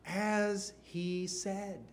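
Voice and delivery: "as he said" drawn out very slowly; slow, pointed quote. Shown as a demonstration. Preacher to congregation, very slow emphasis. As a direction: lean on each word, slowly. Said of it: "Notice these next words".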